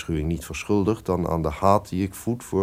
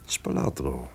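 hushed whisper of his name; 7 semitones lower, 7 dB quieter than usual. Acted. Duke whispers to his servant before a murder plot, hushed. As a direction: draw him close with a secret whisper.

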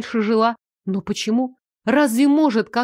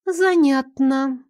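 musing villainess; 4 semitones higher, much slower than usual. Acted. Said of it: "резюмировала она".

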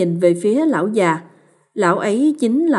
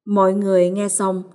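plain reading voice

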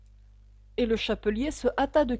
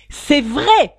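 loud, high retort; shouting; 6 semitones higher, 14 dB louder than usual. A narrator's reading. The hatter contradicts the hare, shouting. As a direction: shout back in a squabble. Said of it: "cria le chapelier".

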